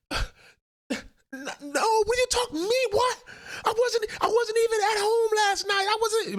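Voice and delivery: put-on very high, whining little-kid voice; whiny child denying. Kid caught sneaking food at night, whining high denial. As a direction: plead innocence like a caught kid.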